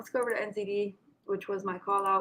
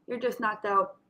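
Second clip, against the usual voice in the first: ordinary talk, nothing marked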